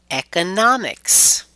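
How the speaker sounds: "economics" with NOM stressed and stretched; stress before suffix. Shown as a demonstration. Teacher shows the -ics rule, "economics" stressed on NOM. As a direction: lift and punch NOM in "economics". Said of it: "we stress right before it".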